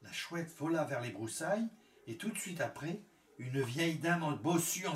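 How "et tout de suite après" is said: usual speaking voice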